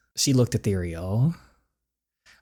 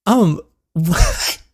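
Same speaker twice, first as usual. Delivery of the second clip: teary laugh; 8 semitones higher, 10 dB louder than usual. Acted. Tearful laugh on seeing him.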